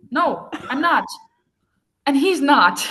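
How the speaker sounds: breaking into laughter mid-defiance; can't hold it together, laugh; laughing through line. Real, not acted. Ava defies Alex.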